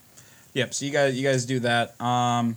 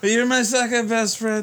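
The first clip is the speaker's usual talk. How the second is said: put-on drunk voice; 8 semitones higher, 3 dB louder than usual. Drunk Stan praising player.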